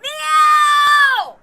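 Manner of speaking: high, loud roar of the name; shouting back mid-clash; battle shout. Acted. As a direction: hurl it back at him. Answering battle cry mid-fall.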